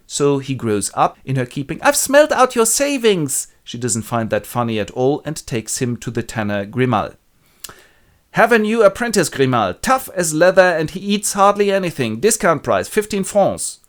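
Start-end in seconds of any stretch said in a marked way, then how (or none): 1.8-3.5 put-on high, loud kid voice; boy taunting. The boy teases Madame Gaillard about her savings. 8.3-13.8 high, loud hawking voice; selling the boy. Acted. Madame Gaillard sells the boy to Grimal, loud pitch.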